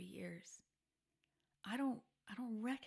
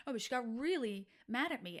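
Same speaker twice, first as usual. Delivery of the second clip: ordinary talk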